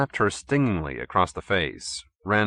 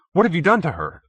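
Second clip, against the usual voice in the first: higher, louder pleading; begging; 9 semitones higher, 5 dB louder, faster than usual. A narrator's reading. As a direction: plead desperately. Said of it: "he begged".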